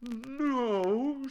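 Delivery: high, loud, drawn-out denial; airy bear brushing it off; careless. A narrator's reading. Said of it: "said Winnie the Pooh carelessly".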